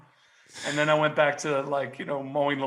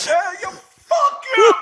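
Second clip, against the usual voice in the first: much higher, louder slow yell; mock yell; 10 dB louder, slower than usual. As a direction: bellow it back at the son, hamming it up. Dad yelling back at son.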